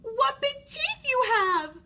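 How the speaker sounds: high, loud, slow astonishment; alarmed little girl. Acted. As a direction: stretch it in wide-eyed alarm. Girl sees the sharp teeth.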